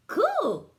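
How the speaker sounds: very high, loud cool; squeaky delighted alien; impressed alien. A narrator's reading. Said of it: "said Bork".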